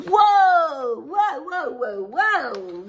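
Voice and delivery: high, loud drawn-out whoas; mock surprise. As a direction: act stunned for preschoolers. Teacher reacts to story page.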